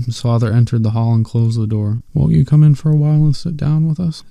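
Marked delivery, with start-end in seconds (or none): 2.1-4.2 mother voice. A narrator's reading. Mother's voice in story.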